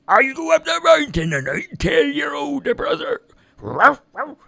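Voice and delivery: high, bright-eyed promise then a bark; eager dog voice. A narrator's reading. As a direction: answer eagerly like a helpful dog. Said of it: "Pepper said".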